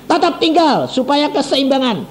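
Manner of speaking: a hint of a stern regent shouting; mock shouted order. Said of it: "bupatinya teriak-teriak pakai mikrofon".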